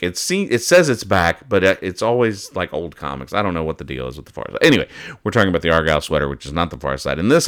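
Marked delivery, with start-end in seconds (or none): none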